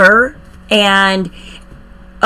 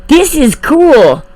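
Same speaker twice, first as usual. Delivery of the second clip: excited; 6 semitones higher, slower than usual. Real, not acted. Reacting to video title.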